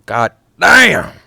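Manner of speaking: loud high shouted sing-along; loud gleeful shouting; excited shout. Real, not acted. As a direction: yell it back at the record.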